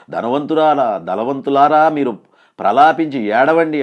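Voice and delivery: loud prophetic warning, slowing at the end; loud proclamation. Acted. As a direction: call out the verse as a rebuke. Preacher quotes warning loudly.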